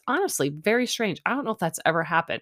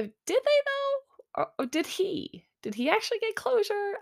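very high, doubting questions; sceptical. Retold or imitated. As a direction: squeak out your doubt. Retold sceptical reaction to closure remark.

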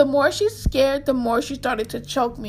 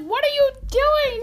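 startled question; 12 semitones higher than usual. Retold or imitated. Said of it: "I'm like".

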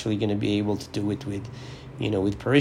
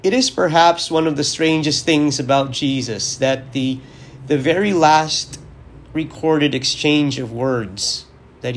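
raised, higher preaching voice with rising urgency; 4 semitones higher, 3 dB louder than usual. Acted. Priest preaching to his listeners on Christ the King, earnest and raised. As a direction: preach it, lifting the key points. Homily on the gospel.

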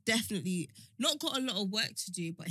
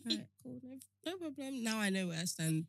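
softly resigned, wounded acceptance; playing the victim; 9 dB quieter than usual. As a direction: accept it quietly while acting wronged. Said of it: "You play victim".

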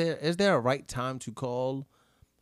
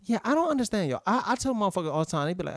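usual speaking voice

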